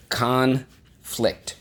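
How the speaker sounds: "Conflict" in two separated beats, CON high; stress on first syllable. Shown as a demonstration. Teacher repeats the word syllable by syllable for pupils, first-syllable stress. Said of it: "the accent is on the first syllable".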